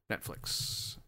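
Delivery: very soft, voiceless whisper; whispered incantation. Pretend. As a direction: whisper the name as if coaxing it. Coaxing site to load, whispered.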